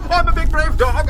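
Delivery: loud, high, put-on bragging; brave dog shout. Bane psyching himself up in the woods, shouting. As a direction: bellow the brag. Bravado chant.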